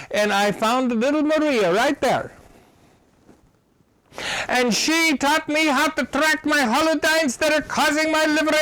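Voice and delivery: high, loud, earnest voice of a tall Russian woman; grateful Russian lady. Retold or imitated. A wealthy Russian woman thanks Dr. Wolf, high and earnest. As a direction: play her pouring out thanks to the doctor who helped her.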